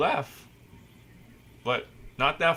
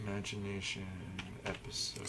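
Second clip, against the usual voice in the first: hushed whisper; 9 semitones lower, 17 dB quieter than usual. Pretend. Dramatic hushed title announcement.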